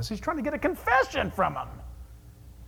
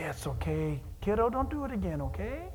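softer, lower reassurance; gentle comfort; 3 semitones lower, 7 dB quieter than usual. Acted. Parent picks up a busted child, soft comfort. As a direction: scoop the kid up and soothe.

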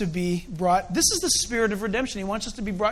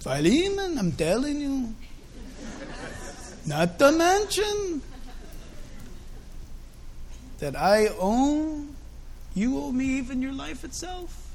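slow, higher imitation of the apostle Paul; preacher acts out Paul writing to Philemon, slow and higher; Paul's voice; 5 semitones higher than usual. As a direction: act out Paul pressing the point, dragging each word. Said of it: "In his Paulineness?".

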